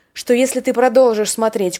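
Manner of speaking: normal talking voice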